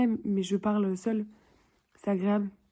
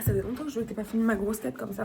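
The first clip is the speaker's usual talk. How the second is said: ordinary delivery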